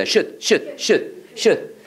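should를 '슛'처럼 짧게 반복; should 끝 d를 막아 받침으로 읽기. Shown as a demonstration. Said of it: "다 받침을 읽어야 돼". (should, 한 음절씩 끊어 '슛, 슛')

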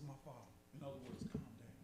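very soft, low, soothing appeal; hushed, tender reassurance; loving, calming. Acted. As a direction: gently talk an angry master down. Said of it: "addresses him lovingly".

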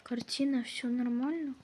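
usual speaking voice

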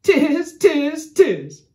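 loud monkey cries, high then dropping; high, loud storybook monkey chant; chattering monkeys. A narrator's reading. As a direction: mimic cheeky monkeys jeering back. Monkeys mimic the angry peddler.

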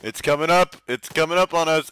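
alarmed. Real, not acted. Enemy vehicle advancing.

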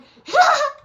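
high, loud burst of laughter; sudden high cackle. Real, not acted. Laughs at own poor rating.

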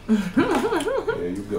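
high, drawn-out laughing. Real, not acted. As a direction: laugh it out slowly. Handing over ranch, laughing.

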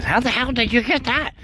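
high-pitched mock astonished woman; imitating Miss Amy. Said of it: "She says".

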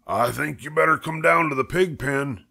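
a farmhand calling his boss; Lurvy voice. Acted.

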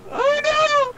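high panicked scream. Real, not acted. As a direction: shriek in alarm. Panic during police chase.

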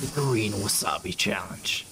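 hushed echo of the clip; whispering. Retold or imitated. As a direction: whisper it back dramatically. Mimics whispered clip intro.

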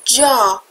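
جا said as plain jah, no w; Jaw with a silent w. Shown as a demonstration. Teacher showing students that the w in Jaw is silent. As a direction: say جا with no w sound at the end. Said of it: "دبلیو خونده نمیشه".

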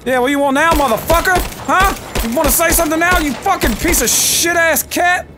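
trash-talk the beast as you shoot; swearing tirade. Real, not acted. Taunting the cougar while shooting.

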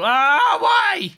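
high, loud cry of protest; alarmed shout. Real, not acted. Player protesting the pour going wrong, alarmed shout. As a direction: yell it, rising high. Mishap with pouring in game.

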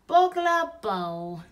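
put-on slow, mangled toddler babble; baby voice. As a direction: garble it like a baby. Baby tries again.

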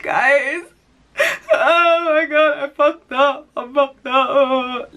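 high, loud, wailing mock sob through laughter; mock bawling. Calling her girls, hammed-up crying wail. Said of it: "literally crying, bawling".

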